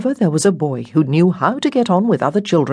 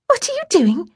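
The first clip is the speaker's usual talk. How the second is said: put-on high-pitched child's voice; perky little-girl question; eager little girl. A narrator's reading. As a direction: ask brightly like an eager little sister. Little girl happily greets the boys.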